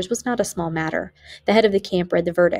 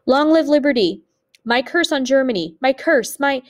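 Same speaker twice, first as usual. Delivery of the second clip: raised, defiant last cry; strong, calm shout; 7 semitones higher, 4 dB louder than usual. A narrator's reading. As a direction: call it out high and firm, cut off at the end. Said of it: "shouted in a strong, calm voice".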